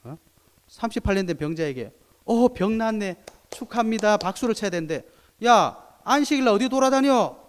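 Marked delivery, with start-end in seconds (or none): 2.2-4.2 higher, louder cheerful congratulation; mock congratulations. What they should have said. 5.4-7.4 much higher, louder indignant yell; mock angry yell.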